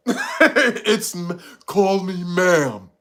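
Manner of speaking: slow, drawn-out put-on voice; mock stern correction. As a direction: imitate someone insisting on a title, words stretched. Imitates someone correcting how addressed.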